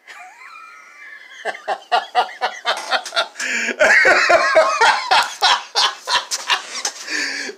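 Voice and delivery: long, loud high-pitched cackle; laughter. Real, not acted. Vlogger declaring a comedian's career dead, then long loud laughter. Laughing at his own announcement.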